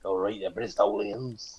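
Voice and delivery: put-on slow, drawn-out Bristol accent; hammed-up Bristol greeting; mock Bristolian. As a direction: lay on a broad West Country twang. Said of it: "the Bristol accents".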